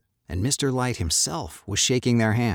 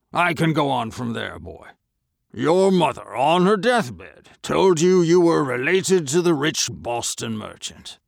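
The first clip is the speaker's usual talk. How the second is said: louder, higher merchant voice, contemptuous; scornful old merchant; 7 semitones higher, 4 dB louder than usual. Acted. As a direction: sneer down at a beggar boy. Merchant mocks the boy.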